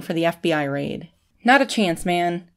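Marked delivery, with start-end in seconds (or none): none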